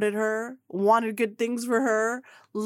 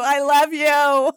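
slow, louder, higher declaration; gushing fan; 5 semitones higher, 6 dB louder, slower than usual. Retold or imitated. Fan repeating love to Kim, slow and loud. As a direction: lean on every word, starry-eyed. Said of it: "I go".

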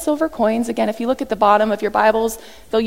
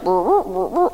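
eyes spinning noise; 3 semitones lower, slower than usual. Retold or imitated. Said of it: "Peter's eyes would have been like".

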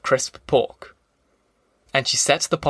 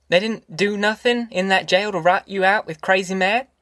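higher, tentative voice with rising questions; timid mother; 5 semitones higher than usual. Acted. Ma asking Tom about jail, timid and hesitant. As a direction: ask haltingly, pitched up, as a shy country mother.

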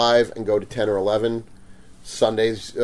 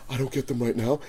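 impression of the customer; 11 dB quieter than usual. Mimicking the customer at the booth, softly put on. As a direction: drop to a soft mimicry of him. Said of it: "It's the impression".

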